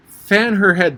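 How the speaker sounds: lightly acted alarm; anxious interruption. A narrator's reading. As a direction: cut in with urgent concern. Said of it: "Red Queen anxiously interrupted".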